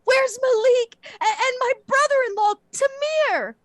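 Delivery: high, loud, tearful wailing; acted panic. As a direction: wail hysterically for your family, hammed up. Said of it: "like, panic".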